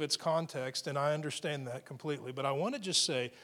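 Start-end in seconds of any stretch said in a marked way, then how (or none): none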